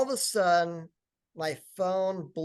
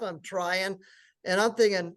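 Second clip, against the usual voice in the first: normal talking voice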